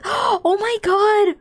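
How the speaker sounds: gasp, then slow drawn-out oh my god; gasping shock. Real, not acted. Startled by her setup shutting off mid-sentence, gasping. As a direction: caught off guard, gasp and stretch it.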